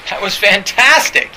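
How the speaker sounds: high, loud mock acclaim; gushing praise. Audience after the imagined talk, gushing praise. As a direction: play an adoring audience, voice jumping up. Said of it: "everybody's gonna clap and go".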